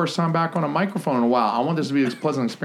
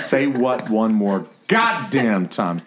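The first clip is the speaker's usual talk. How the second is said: slow line, leaning hard on "goddamn"; stress on GODDAMN; slower than usual. Shown as a demonstration. Coach shows more inflection on "goddamn", stressed and drawn out. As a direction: punch and stretch "goddamn". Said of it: "a little bit more inflection on the goddamn".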